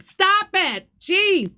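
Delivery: high, sharp cry of exasperation; exasperated outburst. Real, not acted. Host fed up with a viewer's accusation, exasperated high outburst.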